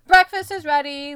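loud, high call to the house; yelling. A narrator's reading. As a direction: call everyone to breakfast. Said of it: "Liam yells".

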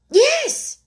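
very high, loud answer; shouted. A narrator's reading. Alice answers the Queen, shouting. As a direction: shout it eagerly across. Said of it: "shouted Alice".

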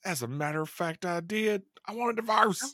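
high, indignant imitation of a stepmom; hammed-up high-voiced angry retort; mock angry. Co-host acts out the stepmom wanting a divorce, mock angry. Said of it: "She was angry".

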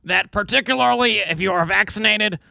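put-on reading a quote in a high Brooklyn accent. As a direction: raise the pitch, thick Brooklyn accent. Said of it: "little Brooklyn accent".

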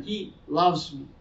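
ordinary talk

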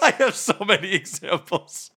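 high, giddy laughing delivery. Real, not acted. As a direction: say it through real laughter. Cracking up over the examples.

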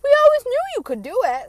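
high, loud sarcastic mimic of relatives; mocking family members. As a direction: squeal it like fake proud family. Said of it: "be like".

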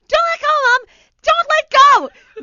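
very high, full-volume shout; mock panicked yell. Acts own yell to mother.

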